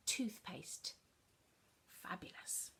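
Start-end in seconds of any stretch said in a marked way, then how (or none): 2.0-2.7 Fabulous whispered softly; whispered praise. Acted. Teacher whispering praise to the children. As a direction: praise in a hushed voice.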